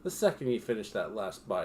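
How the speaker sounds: ordinary talk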